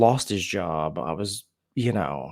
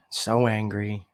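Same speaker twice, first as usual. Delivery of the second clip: usual speaking voice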